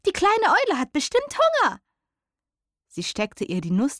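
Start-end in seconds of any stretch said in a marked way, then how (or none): 0.1-1.8 very high, eager squirrel call. A narrator's reading. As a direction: squeak it out like an excited squirrel.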